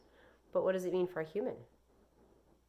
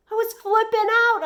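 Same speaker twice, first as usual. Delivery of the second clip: put-on very high, loud, panicked boy; frantic boy; 15 dB louder than usual. A narrator's reading. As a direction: blurt it out, near tears. Joey frantic.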